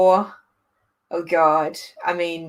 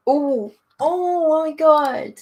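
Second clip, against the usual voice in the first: high, loud, drawn-out oh my God; real excited outburst; thrilled surprise; 10 semitones higher, 7 dB louder, much slower than usual. Real, not acted. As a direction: gush it slowly in delighted shock. Reacts to the next show.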